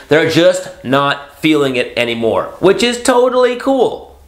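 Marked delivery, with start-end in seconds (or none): 2.6-4.2 high, slow, sing-song "totally cool"; mock breezy approval. Mocking the ghoster to his audience, high and drawn out. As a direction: play it like a sarcastic shrug.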